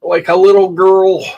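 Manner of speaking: sobbing through a self-mocking line; real crying. Real, not acted. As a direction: let the crying take over the words. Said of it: "I'm crying my eyes out".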